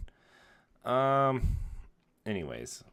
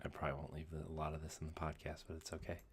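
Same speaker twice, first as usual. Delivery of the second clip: hushed golf commentary voice; golf announcer whisper; 2 semitones lower, 11 dB quieter than usual. Real, not acted. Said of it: "like in my golf voice".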